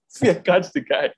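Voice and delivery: ordinary talk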